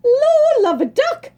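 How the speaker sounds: put-on high, loud old-fashioned oath; a barmaid's startled cry; startled exclaim. A narrator's reading. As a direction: jump and blurt it out. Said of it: "she exclaimed".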